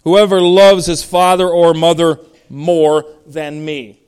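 loud, slow verse leading up to "me"; emphatic reading. Shown as a demonstration. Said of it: "He mentions himself in an emphatic way".

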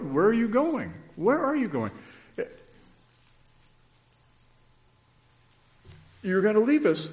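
high-pitched urgent repeats; anxious Peter. Acted. Peter begging Jesus not to leave, high urgent pleading.

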